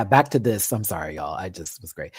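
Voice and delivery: usual speaking voice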